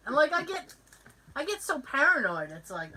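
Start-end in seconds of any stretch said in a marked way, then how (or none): none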